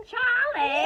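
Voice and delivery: loud call across a room. Retold or imitated. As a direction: call his name out. Calling out across the shop.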